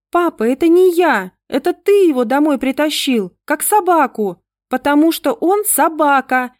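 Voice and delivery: put-on high, loud child's outcry; shouted out. A narrator's reading. Daughter shouts at her father, accusing him, loud and high. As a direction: yell it back at dad, fists clenched. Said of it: "выкрикнула Ангелинка".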